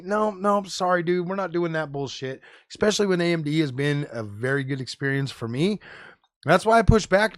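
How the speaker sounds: ordinary talk, nothing marked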